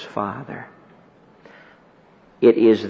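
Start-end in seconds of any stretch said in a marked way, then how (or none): 0.0-0.7 very soft, hushed "Father", rising a little; a tender whisper of "Father". Acted. First word on the cross.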